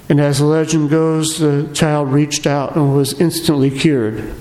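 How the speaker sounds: ordinary talk, nothing marked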